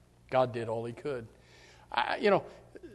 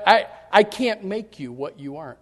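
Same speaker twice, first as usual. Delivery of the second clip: ordinary delivery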